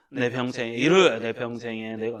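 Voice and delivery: "내 평생에 내가"를 힘없이 흐물흐물, 더 낮게 천천히; 기운 빠진 채 흐지부지 읽기. Shown as a demonstration. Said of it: "이렇게 읽으시면 안 돼요". (잘못 읽는 예, 흐물흐물 힘없이)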